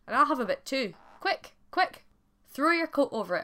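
high, excited, hurried-sounding commands; urgent urging. A narrator's reading. Wife wants husband to trap the bannock, urgent urging. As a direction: press the husband to act at once.

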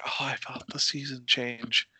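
hushed, wistful whisper; whispered quote, softly delighted; dreamy whisper. Retold or imitated. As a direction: breathe it like a small private wonder. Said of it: "I get this nice, lovely feeling".

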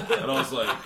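ordinary delivery

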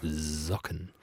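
soft, low "Socken" with drawn-out z; voiced S. Shown as a demonstration. Host testing vibration on own head, buzzing S in "Socken". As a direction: quietly buzz into "Socken", feeling it vibrate. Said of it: "Das Gefühl ist auch ganz angenehm".

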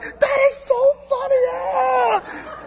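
extremely high squealing neighbor impression; hammed-up high squeal of delight; Laurie. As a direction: mimic an overexcited neighbor, ending in a wail. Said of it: "That's how she talks".

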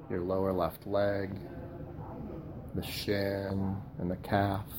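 slow, soft body-scan voice with long pauses; guided meditation. Acted. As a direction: guide them gently through the body, softly and unhurriedly.

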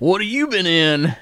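a bad Southern yokel asking; Southern accent. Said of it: "You need to work on your Southern accent".

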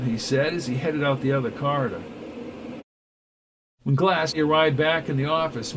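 ordinary delivery

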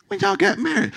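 surprised. Acted. Mock surprise at a churchgoer.

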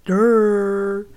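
loud, drawn-out dopey jeer; mocking duh. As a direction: make fun of an obvious point, loudly. Mocks co-host for missing the obvious.

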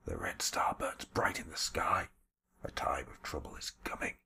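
put-on hushed, breathy old man's voice, defiant but broken; a beaten elder whispering a prophecy; whispering old shaman. Old shaman whispers defiance to the bluecoats beating him.

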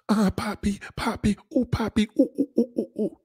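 hammed-up Spanish woman moaning; mock moaning woman. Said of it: "Spanish woman just gonna be like".

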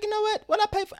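squeaky incredulous inner voice; mock outrage. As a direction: act out your own indignant self-talk.

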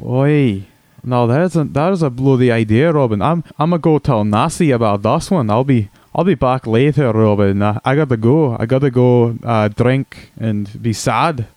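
hammed-up Scottish brogue; broad comic Scottish accent. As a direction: play a crude Scotsman caller on the radio. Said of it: "crude impression of a Scotsman".

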